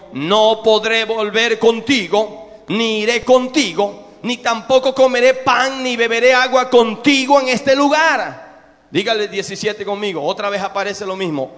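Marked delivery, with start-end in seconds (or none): 0.1-8.4 slow and firm, building to louder; firm refusal. Acted. Man of God refusing the old prophet, firm and loud. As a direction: plant your feet and refuse.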